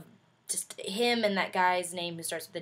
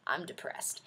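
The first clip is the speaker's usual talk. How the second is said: low, hushed deadpan; mock gloom; 6 semitones lower, 8 dB quieter than usual. Shrugging off people laughing at her, low and gloomy. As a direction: mutter it flatly, moping. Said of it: "but I was like".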